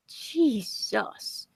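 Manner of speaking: ordinary delivery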